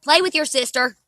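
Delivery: loud call across the yard. Real, not acted. Mom calls out to her kids.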